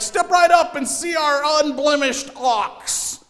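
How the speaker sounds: high, loud carnival barker pitch; chirpy trade show pitch. Retold or imitated. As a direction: hawk it like a fairground barker. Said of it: "he's like".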